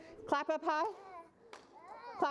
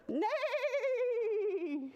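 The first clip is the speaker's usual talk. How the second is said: high, long, wobbling whinny; whinny out like a horse; bellowed horse neigh; 10 semitones higher than usual. A narrator's reading. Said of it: "bellowed out a".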